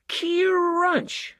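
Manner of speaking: very high squealed Crunch!; mock crunch. A narrator's reading. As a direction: mimic the crash with glee. Tree crashes on car.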